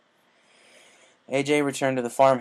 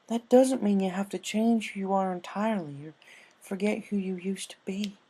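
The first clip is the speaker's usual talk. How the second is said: gentle comfort; 9 semitones higher, 3 dB louder than usual. A narrator's reading. Applejack gently comforting crying Rainbow. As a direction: talk her through it gently.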